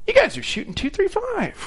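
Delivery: imitating a surprised boss; mock surprise. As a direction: act caught off guard. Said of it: "he looks up at the monitor and he goes".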